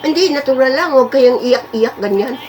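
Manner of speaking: high-pitched imitation of a nagging neighbour; imitated high-voiced objection to crying; mock disapproving neighbour. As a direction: mimic a neighbour telling someone off, voice pitched high. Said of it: "sasabihin pa nung kapitbahay".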